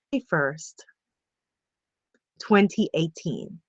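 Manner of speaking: low, softening meditation voice with long pauses; guided visualization. Real, not acted. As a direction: guide listeners gently, leaving space between phrases.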